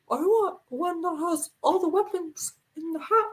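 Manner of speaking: mock kid voice, drawn into character; Dante. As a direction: imitate a small child with an oddly husky big voice. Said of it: "this is what Dante sounds like".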